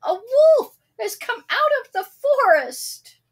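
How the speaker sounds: high, loud, crying alarm; shouting. A narrator's reading. Sam wailing a false alarm again. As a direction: wail it to the whole village. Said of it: "he shouted".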